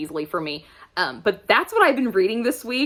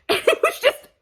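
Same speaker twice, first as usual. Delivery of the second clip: way up high, giggling in disbelief; laughing. Real, not acted. Reacting to the pool scene for viewers, laughing. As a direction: overcome with amused exasperation. Cracking up at the petty couple.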